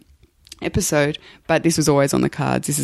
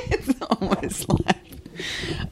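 laughing; 4 semitones higher, 7 dB quieter, much slower than usual. Real, not acted. Laughing reply to co-host.

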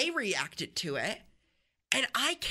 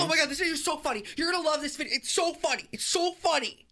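higher, louder, fast gush; mock hyping; 7 semitones higher, 4 dB louder, faster than usual. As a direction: oversell the video breathlessly. Hyping a video, acted.

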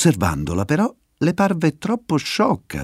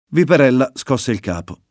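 ordinary delivery, nothing marked

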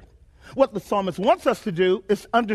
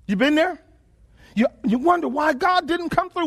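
ordinary talk

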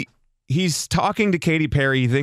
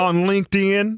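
higher, baffled question; puzzled Luke; 5 semitones higher than usual. Retold or imitated. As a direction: ask it like an odd non sequitur. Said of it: "What did he say".

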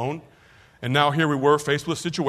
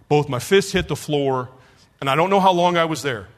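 ordinary delivery

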